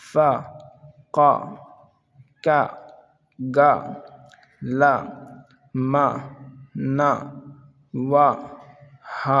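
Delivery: each syllable given alone with pauses, teacher-style; syllable drill. Shown as a demonstration. Teacher drilling students, one syllable at a time.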